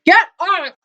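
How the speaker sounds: loud, high yell; shouting. A narrator's reading. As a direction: shout it to fend off an attacker. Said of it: "The man shouted".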